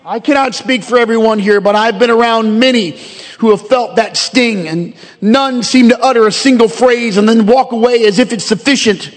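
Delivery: ordinary delivery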